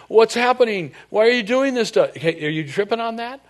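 high, loud alarmed squeals; a hammed-up terrified demon; panicked demons. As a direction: play a demon losing its nerve.

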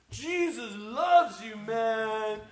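put-on high, very slow drunken holler; drunk friend calling out. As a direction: drag it out like a drunk greeting passersby. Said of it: "it's my drunk friend".

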